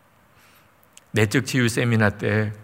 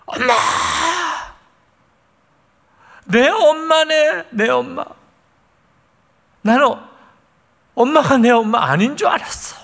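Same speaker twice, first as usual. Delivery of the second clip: crying out for mom; 13 semitones higher, 4 dB louder than usual. Retold or imitated. Daughter bursting in on her mother, sobbing loud cries. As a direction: wail it out, tears breaking through. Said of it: "얼마나 우는지".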